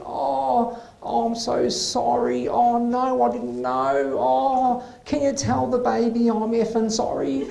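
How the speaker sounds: high, sugary over-apologetic voice; patient suddenly sweet to the doctor, hammed-up apologetic; sudden apology. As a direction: gush a sweet apology, mock innocent. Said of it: "all of a sudden she's all apologetic".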